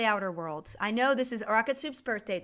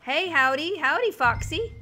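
put-on southern accent; 4 semitones higher, 6 dB louder than usual. To chat viewers, louder, lightly played Southern accent greeting. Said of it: "getting my southern accent out".

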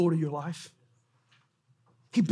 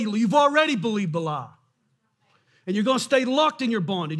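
ordinary delivery

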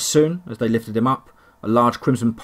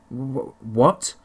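squeaked. Acted. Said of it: "he squeaked".

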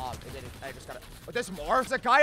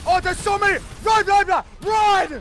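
being chased; 10 semitones higher, 8 dB louder, slower than usual. Real, not acted. Panicked escape from many enemies.

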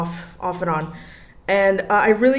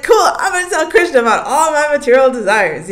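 eager; 7 semitones higher, 7 dB louder than usual. Retold or imitated. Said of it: "I was like".

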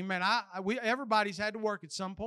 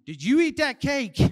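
louder mock scolding, falling at the end; accusing mom; 4 semitones higher, 6 dB louder than usual. Shown as a demonstration. Mom turns on the kid with the same question, loud accusation. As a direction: imitate a mom demanding a confession. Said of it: "my mom turns around and uses the same question".

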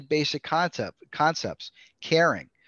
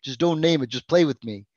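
put-on mildly whiny child voice, a bit louder; daughter's complaint; 3 semitones higher, 6 dB louder than usual. Eight-year-old telling her dad to stop naming it, a touch whiny. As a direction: lightly play the eight-year-old pleading. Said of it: "She says".

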